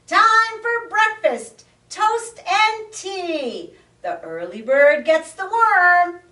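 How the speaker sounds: high, loud, sing-song call; chirpy shout. Acted. Frog peeps again at the top of his lungs.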